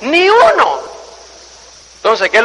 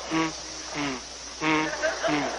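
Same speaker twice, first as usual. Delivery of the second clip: soft straining hum; 6 semitones lower, 17 dB quieter, much slower than usual. Retold or imitated. Baby straining to take a step.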